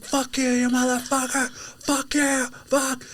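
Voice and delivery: high, strained mock fury; hammed-up high-pitched angry yell; retold cussing yell. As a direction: imitate a driver screaming curses. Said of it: "and then started yelling".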